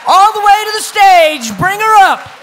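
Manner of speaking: loud host call to the stage. Real, not acted. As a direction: call her up loudly over the crowd. Host calling poet to stage.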